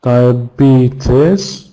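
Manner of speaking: "Diabetes" with BE stretched, rising at the end; stressed syllable marked. Shown as a demonstration. Teacher marking stress for learners, "Diabetes" drawn out on BE. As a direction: lean long on the BE in "Diabetes". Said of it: "second syllable".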